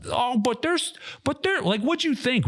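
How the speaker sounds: high, hesitant mock whine; mocking whiny squad member. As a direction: parody the nervous objection.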